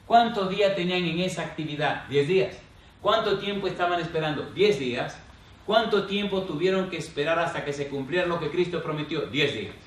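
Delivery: loud, high rhetorical questions to the congregation; full-force preaching. Acted. (predicación enérgica, preguntas en voz alta)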